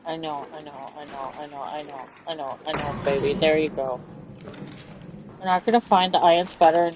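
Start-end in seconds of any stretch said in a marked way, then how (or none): 0.0-3.3 soothing. Acted. Soothing game character tenderly. 3.3-4.0 soft cooing. Acted. Soft voice to game character.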